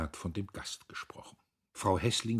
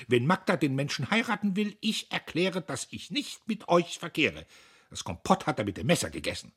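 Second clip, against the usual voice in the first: loud, high indignant outburst; losing restraint; 10 semitones higher, 7 dB louder than usual. A narrator's reading. Sister denounces the suitor at table, losing restraint. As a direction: burst out, unable to hold back. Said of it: "Emmy hielt sich nicht mehr".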